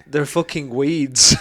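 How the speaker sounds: drawn-out imitation of a scornful verdict; retold blunt put-down. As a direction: punch it out slowly, quoting her. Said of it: "and, and she went".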